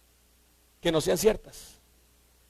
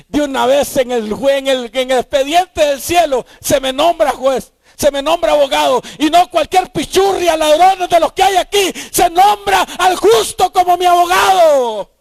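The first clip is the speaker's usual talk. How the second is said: triumphant shouted sermon climax; preaching at full force; 4 semitones higher, 5 dB louder than usual. Acted.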